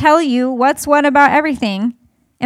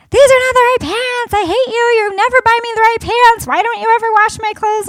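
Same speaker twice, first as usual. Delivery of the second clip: imitating a tantrum; 8 semitones higher than usual. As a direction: throw a child's tantrum, hammed up. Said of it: "Addie is in her room screaming and yelling".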